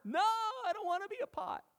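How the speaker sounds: very high, loud whiny pot voice; hammed-up high voice of a stubborn pot; defiant clay pot. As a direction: play the clay talking back to the potter. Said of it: "Does the clay say to the potter".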